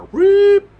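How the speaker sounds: a sharp, very high, loud whoop like a siren; loud imitated siren yelp. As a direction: mimic the patrol car's siren chirp.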